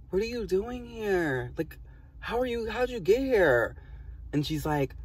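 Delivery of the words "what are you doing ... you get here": high, drawn-out, incredulous questioning; bewildered reaction. Retold or imitated. Storyteller re-enacts questioning the imagined friend, high and bewildered. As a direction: question an impossible visitor in a high, stretched voice.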